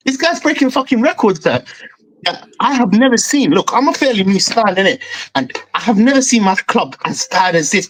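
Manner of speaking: normal talking voice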